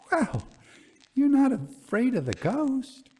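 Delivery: can't believe a soldier fears it; a lady's high-pitched disbelief; astonished. Acted. Young lady astonished at the colonel.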